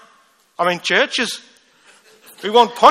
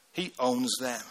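"He owns them" said much softer, almost under the breath; hushed repeat; 5 semitones lower, 9 dB quieter, slower than usual. Acted. Preacher quietly repeating to the congregation, much softer. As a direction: let the words sink in softly. Pastor repeats the point, dropping low.

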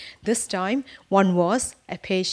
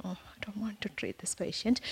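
a reluctant whisper to herself; whispered thought; 8 dB quieter than usual. Retold or imitated. Nurse dreading her difficult patient, thinking in a whisper. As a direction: breathe it out under her breath, dreading it.

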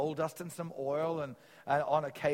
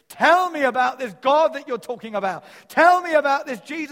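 loud, high, pleading cry; desperate plea; 11 semitones higher, 16 dB louder than usual. Retold or imitated. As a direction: beg him to tell you, at full voice. Soldier begging, voiced loudly.

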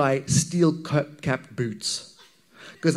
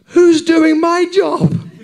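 high, slow, shouted self-question; loud; 13 semitones higher, 11 dB louder, much slower than usual. Pretend. Preacher shouting his worried question at himself. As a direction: cry it out at full stretch, slow and high.

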